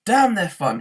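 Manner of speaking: loud furious shout; angry shout at the demolition. A narrator's reading. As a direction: spit it out in anger. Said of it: "he shouted".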